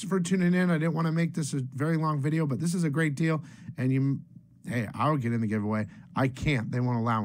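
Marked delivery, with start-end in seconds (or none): none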